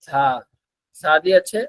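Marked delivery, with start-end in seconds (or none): none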